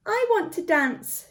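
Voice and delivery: mock eager exclamation; eager cry. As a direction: cry it out with longing. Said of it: "she cried".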